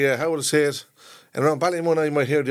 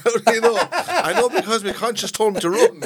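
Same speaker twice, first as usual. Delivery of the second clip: laughing; 8 semitones higher, 4 dB louder than usual. Pretend. Joking imagined exchange with cop.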